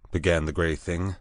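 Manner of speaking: ordinary delivery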